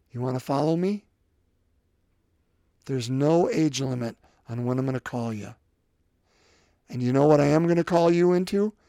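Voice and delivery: soft, low invitation; intimate call. Pretend. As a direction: draw them in gently.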